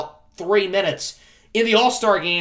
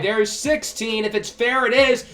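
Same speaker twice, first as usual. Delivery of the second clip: building, higher and louder home run call; excited play-by-play; 5 semitones higher, 5 dB louder than usual. Pretend. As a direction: count up to the winning hit, then let it rip.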